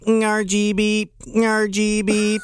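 high beeping robot voice. Acted. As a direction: beep it out like a toy robot. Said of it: "Doing your robot voice".

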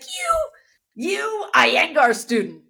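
high, drawn-out mock accusation; retold taunt. Teacher singles out a student mid-practice, slow mock taunt. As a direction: imitate a teacher slowly branding the student. Teacher's insult, acted in story.

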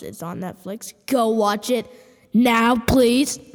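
ordinary delivery

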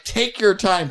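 high, loud mock insistence; imitated urging. Buddy sending him to the shower, imitated urging. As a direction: press the point as the exasperated buddy.

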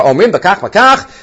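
ordinary talk, nothing marked

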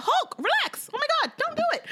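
high-pitched panicked plea; high pleading cry. Retold or imitated. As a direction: beg him to calm down, squealing. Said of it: "I said".

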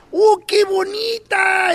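hammed-up high, slow cry of delight; mock delighted gasp. Imitating the owner admiring the little box, hammed-up high delight. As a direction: gush over the box like a thrilled lady.

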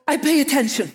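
gasp, then blurt it out high and loud; starstruck, bursting with recognition; excited gasp. Retold or imitated. Excited gasp on meeting the woman from her dream.

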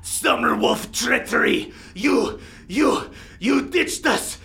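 high, strained shout of accusation; Toma. Acted. Winter wolf accuses those who left them to die, shouting. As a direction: cry out as a betrayed winter wolf. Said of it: "Toma of the Winter Wolves, being like".

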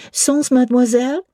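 put-on high child voice asking in French; little French girl. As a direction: ask it like an eager little girl. French child Adele speaks.

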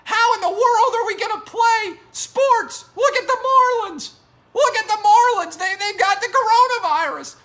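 very high, loud hysterical caricature; Corona Bro voice. Acted. Host mocking doomsayers fretting over the Marlins, shrieky Corona Bro voice. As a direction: wail it in a hammed-up panicky falsetto. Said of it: "this is the Corona Bro voice".

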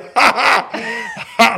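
laughing with lips pressed shut; closed-mouth laugh. Shown as a demonstration. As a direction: laugh without showing your teeth. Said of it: "I'm laughing like this".

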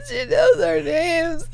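high, slow, half-sobbing awe; overwhelmed that she knows them; high, slow laughing near-tears; starstruck. Retold or imitated. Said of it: "And I was just like".

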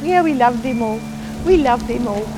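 ordinary delivery

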